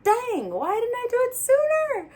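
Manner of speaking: high, loud mock exclamation of disbelief; squealing "why didn't I" realization; amazed outburst. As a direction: blurt it out like it just hit you. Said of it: "I'm like".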